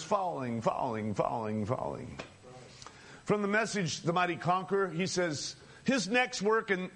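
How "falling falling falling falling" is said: sinking repetition. Acted. Preacher picturing the world's fall, voice sinking with it. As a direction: step the pitch downward on each "falling".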